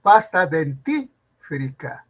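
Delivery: dentífrica slowly, as den-TÍ-fri-ca; correct pronunciation dentífrica. Shown as a demonstration. Teacher modelling the right term, dentífrica slowly as den-TÍ-fri-ca. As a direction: punch the TÍ in dentífrica, r after the f. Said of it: "¿Cómo debe decir?".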